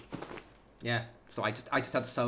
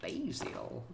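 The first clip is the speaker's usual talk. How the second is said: softer mock-American "Basil", BAY-zil; mock American accent; 7 dB quieter than usual. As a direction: repeat "Basil" quietly with an American "bay" vowel. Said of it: "it's called basil, innit".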